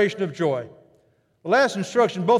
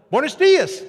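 high loud Buenos dias; beaming greeting; 9 semitones higher, 5 dB louder than usual. Retold or imitated. Hotel attendant greeting guests, beaming and loud. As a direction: sing it out with a big smile. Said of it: "lit up".

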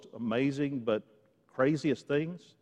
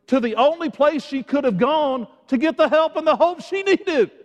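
raised, driving preacher's voice; impassioned preaching; 11 semitones higher, 9 dB louder than usual. Real, not acted. Preacher marvels to congregation at what Jesus does, impassioned. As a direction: build the amazement with punchy emphasis.

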